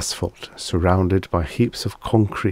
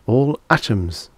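ordinary delivery

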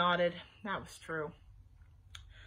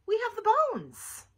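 high, louder indignant protest; insistent; 12 semitones higher, 6 dB louder than usual. A narrator's reading. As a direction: push back hard, pitched right up. Insistent argument over proof.

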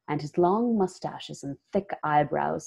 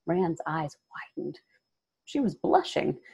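ordinary delivery

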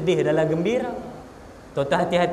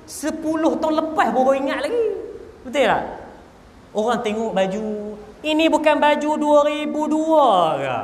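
mock gossip; 8 semitones higher than usual. Gossips eyeing a woman's old baju, in a high jeer. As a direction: tease like a nosy neighbour. People mocking women's old clothes.

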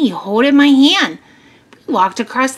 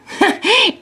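short, higher-pitched laugh; acted little chuckle; farmer's chuckle; 10 semitones higher than usual. A narrator's reading. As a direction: chuckle briefly before a confession. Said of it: "Farmer laughed".

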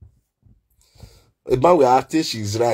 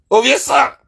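high loud call to Ovesan; calling out a name; 9 semitones higher, 4 dB louder than usual. Acted. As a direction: call his name across the room.

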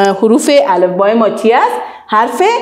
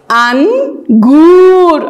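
very slow, stretched and a little louder; drawn-out model word; 4 semitones higher, 3 dB louder, much slower than usual. Shown as a demonstration. Teacher sounding out a fruit name, slowly stretched. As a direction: stretch the first vowel. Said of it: "آواز چی آمد؟".

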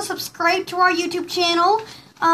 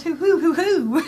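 owl hoots. Retold or imitated. Telling viewers about the early-morning owl, imitated hooting. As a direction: hoot like an owl heard at dawn. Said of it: "there was this owl goes".

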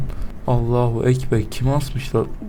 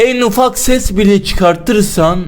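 slow, loud villain threat; drawn-out loud mock menace; menacing threat; 3 semitones higher, 7 dB louder, slower than usual. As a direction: spell out the rules like a horror villain. Menacing intro to scary game.

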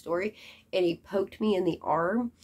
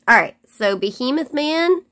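ordinary talk

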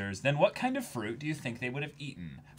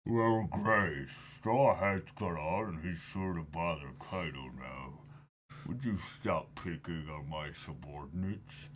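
villain voice; 6 semitones lower, 7 dB quieter than usual. Acted. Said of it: "voicing manga villain lines".

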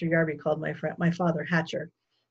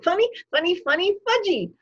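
put-on high, loud gleeful child voice; laughing toddler; 13 semitones higher, 7 dB louder than usual. A narrator's reading. Toddler delights in his gorilla prank, gleeful. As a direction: crow it like a tickled small child. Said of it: "Fudge laughed".

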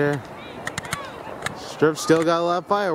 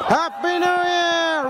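slow drawn-out cheer; new year countdown crowd; 10 semitones higher, slower than usual. Real, not acted. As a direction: stretch it out, celebrating. Midnight cheer.

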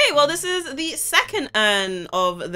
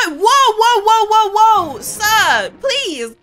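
loud, high burst of panic; high-pitched frantic pleading; alarmed shouting; 8 semitones higher, 8 dB louder, slower than usual. Real, not acted. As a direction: cry out in alarm to stop someone. Man with flamethrower sets fire.

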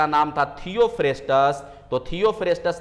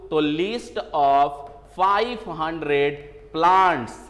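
slow dictation; much slower than usual. Shown as a demonstration. Teacher dictating key fact to students.

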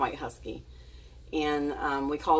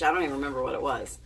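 normal talking voice

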